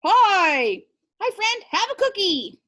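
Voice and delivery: overjoyed superhero offering a cookie; cheery greeting. Acted. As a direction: gush over a new friend. Superhero drawing voiced cheerfully.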